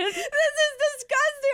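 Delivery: very high, slow grossed-out wail; waking up hungover, very high and grossed out; disgusted groan. Retold or imitated. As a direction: drag out the disgust. Waking with gum in mouth.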